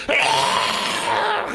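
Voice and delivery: loud, drawn-out imitated scream; sudden scream. Person being prayed over suddenly erupts, mock scream. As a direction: burst into a long, high mock scream. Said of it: "갑자기 컬럭컬럭만 하다가 갑자기".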